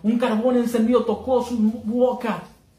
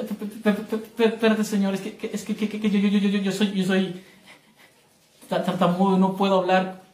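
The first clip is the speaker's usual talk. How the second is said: mock stutter. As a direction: act out a heavy stammer. Moses stuttering to God.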